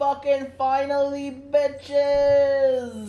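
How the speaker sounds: loud, high victory cry; excited shout. Real, not acted. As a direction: whoop at the arrival. Character finally appears.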